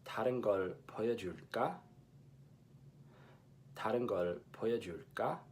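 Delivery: fast Seoul Korean, every consonant clear; 다른 걸 보여줄까 with full 다른 and a clear ㄹ; Seoul standard Korean; Seoul pronunciation. Shown as a demonstration. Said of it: "in Seoul Korean, the sentence is".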